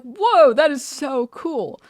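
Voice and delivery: loud, high burst of wonder, drawn out; awed amazement. Retold or imitated. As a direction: exclaim it, totally blown away. Acting awed reaction to friends.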